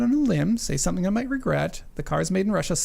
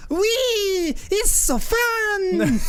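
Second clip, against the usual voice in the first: put-on very high, very slow, drawn-out Ukrainian accent; imitated Ukrainian accent; much slower than usual. Car seller gushing on the test drive, high, slow, hammed-up Ukrainian accent. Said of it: "I wish I could do his accent".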